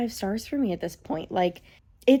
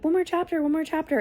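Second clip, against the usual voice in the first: higher-pitched, pleading little chant; eager self-urging; 7 semitones higher, faster than usual. Retold or imitated. Reader bargaining with themselves late at night, eager self-urging. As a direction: imitate coaxing yourself, pitched up.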